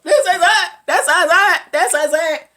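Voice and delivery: loud, high repeated cry; panicked exclamation. Retold or imitated. Heroine being beaten, sure it's over, panicked cry. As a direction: cry it out again and again. Heroine thinks she will die.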